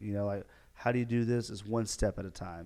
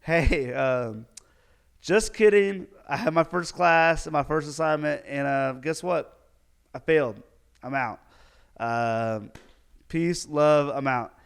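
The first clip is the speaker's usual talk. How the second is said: mock loud announcement; 4 semitones higher, 9 dB louder than usual. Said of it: "And now I'm like".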